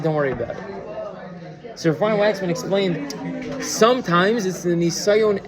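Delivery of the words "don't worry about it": soft and slow, letting it go kindly; slow, soft, generous reassurance to the man who yelled; gentle reassurance. Retold or imitated. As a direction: say it softly, swallowing the hurt. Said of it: "He bit his lip and he just gave me more money and he said".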